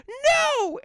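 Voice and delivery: mother shout. Retold or imitated. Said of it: "she's like".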